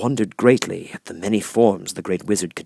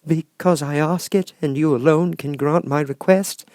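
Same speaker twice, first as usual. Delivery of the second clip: Tin Woodman asking; 8 semitones higher than usual. Acted. Woodman answers Oz.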